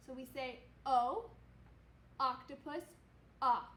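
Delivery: "ah." as an open short o vowel; short o sound. Shown as a demonstration. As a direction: say "ah." like the o in octopus. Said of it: "O says its short sound".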